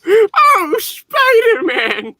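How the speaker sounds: put-on high, squealing old aunt; Aunt May voice. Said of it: "I wanna hear J J's voice done in Aunt May's voice".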